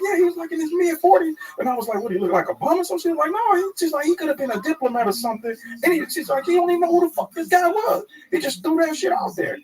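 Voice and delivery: squeaky gossiping woman; high-pitched waitress voice. Retold or imitated.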